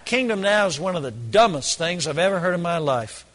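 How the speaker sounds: ordinary delivery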